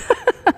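brief high-pitched giggle; a quick, light laugh at your own remark; real amused chuckle; short laugh. Real, not acted. Laughing at her title deeds.

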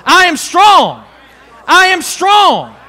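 loud, slow chant of "I am strong"; shouted declaration. Shown as a demonstration. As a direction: lead the church in a shouted confession. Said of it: "say it with me".

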